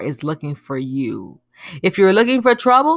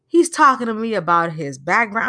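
usual speaking voice

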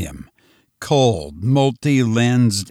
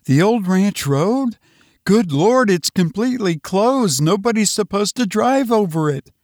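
high-pitched, incredulous woman's voice; alarmed wife; 9 semitones higher than usual. Acted. Wife objects to husband's escape route, alarmed and high.

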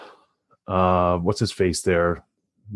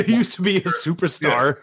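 laughing while talking; 8 semitones higher than usual. Real, not acted. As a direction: chuckle through the line. Laughing through words.

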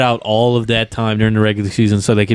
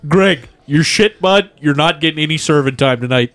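triple-A hockey coach; 5 semitones higher than usual. Acted. Coach berating Greg in a pregame restaurant speech, mock harangue. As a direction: chew him out like a minor-league coach. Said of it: "giving a pregame speech like a triple A coach".

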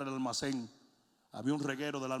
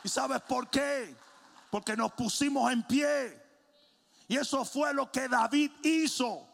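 raised, high-pitched exhortation; forceful preaching; 9 semitones higher, 6 dB louder, slower than usual. Real, not acted. Preacher explains what David did, raised preaching voice. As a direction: drive each phrase home from the pulpit.

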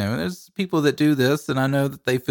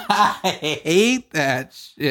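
slow drawn-out groan; 4 semitones higher, much slower than usual. Real, not acted. Laughing exaggerated slow complaint.

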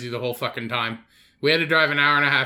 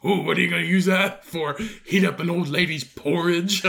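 put-on sarcastic hero voice; comic scornful Batman; mocking Batman; 2 semitones higher than usual. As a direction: tease a rival about a useless power. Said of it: "He's like".

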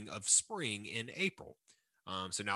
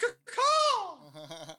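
very high shouted bird squawk; chirping bird person; 6 dB louder than usual. Acted. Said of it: "very good bird, bird, um, voice".

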